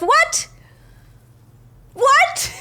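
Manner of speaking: very high, loud, rising question; stunned high squawk; real shocked disbelief. Real, not acted. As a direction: yelp it in sheer disbelief. Told only three chapters are left.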